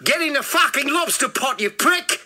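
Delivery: high, forceful yell in an attempted South African accent; angry South African rapper, accent roughly done; second go at South African accent. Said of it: "Something like that".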